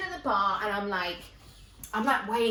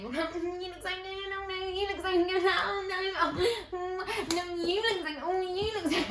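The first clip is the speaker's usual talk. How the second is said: high singsong mock gushing; gushing girlfriends; 9 semitones higher than usual. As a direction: imitate girls cooing compliments over each other. Girlfriends gushing over each other.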